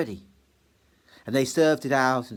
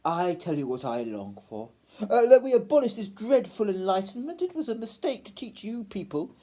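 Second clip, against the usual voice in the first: languid fop; 9 semitones higher than usual. Acted. The tall gentleman sneers at the poor, high and languid. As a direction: sigh it out in lofty boredom.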